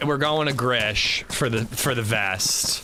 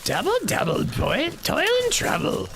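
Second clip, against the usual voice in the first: creepy witch voice. Acted. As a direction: croak it out like a spooky witch. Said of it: "I think I could do an extra creepy witch and or cannibal voice".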